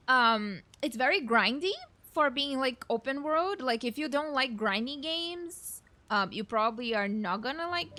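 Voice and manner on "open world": usual speaking voice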